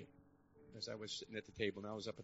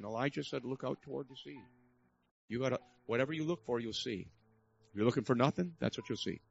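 louder, urgent exhortation to the congregation; rally the room, pushing every line; fiery preacher's call to wake up; preaching at full force; 6 dB louder than usual. Real, not acted.

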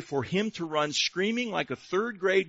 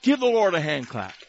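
ordinary talk

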